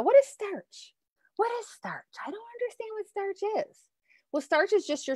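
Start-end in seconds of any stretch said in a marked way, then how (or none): 0.0-3.8 high, soft puzzled viewer voice; a high-pitched confused questioner. Pretend. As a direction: play a baffled student asking.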